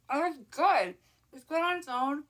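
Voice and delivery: high happy verdict on food; pleased tasting. Real, not acted. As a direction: savor it with delight. Tasting dinner.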